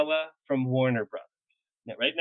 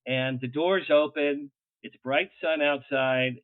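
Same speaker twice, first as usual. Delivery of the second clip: ordinary delivery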